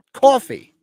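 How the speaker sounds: Coffee as a high, loud "CAW-fee"; wife's coffee pronunciation. Retold or imitated. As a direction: insist on Coffee with a W-like "aw". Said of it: "There's no W in coffee".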